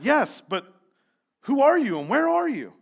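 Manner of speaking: high, loud questioning shout; yelling up. A narrator's reading. Jack asks the unseen voice who it is, loud yell. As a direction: shout your question upward.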